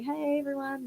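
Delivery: high but soft imitated call; mock crowd hyping. Dancer showing how she hyped the crowd, high, softened mock call. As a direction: imitate working a crowd without the volume.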